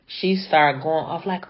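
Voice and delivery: ordinary talk, nothing marked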